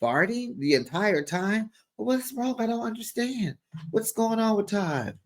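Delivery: high, soft baffled questioning; puzzled. Retold or imitated. Castmate in confessional puzzling over Todd's intestines, baffled. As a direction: wonder aloud in a small high voice. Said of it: "trying to figure out what's wrong".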